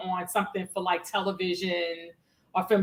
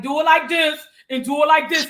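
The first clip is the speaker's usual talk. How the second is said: loud, high, punchy Brooklyn accent imitation; hyped-up Brooklyn guy impression; Brooklyn Mike; 5 semitones higher, 6 dB louder than usual. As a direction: bark it like a Brooklyn big brother. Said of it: "Brooklyn Mike is my big brother".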